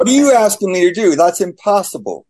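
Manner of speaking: high-pitched, hurried retold objection; incredulous protest. Man retelling his protest to God over loving his dad, incredulous. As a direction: protest in disbelief, pitch jumping well up.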